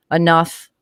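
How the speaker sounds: ordinary delivery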